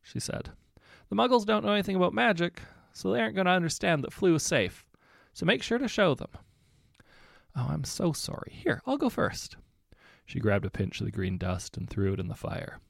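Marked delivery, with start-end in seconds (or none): 1.1-6.3 high, apologetic squeak; flustered young witch. A narrator's reading. Young witch caught out by the professor's warning, flustered and high. Babs explains to colleague. 7.5-9.6 put-on eager young witch. A narrator's reading. Miranda apologizes, eager.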